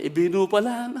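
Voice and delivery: retold line with a laugh through it; laughing recognition. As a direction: laugh as you realize who it is. Old classmate recognizes him laughing. (natatawang pagkakilala)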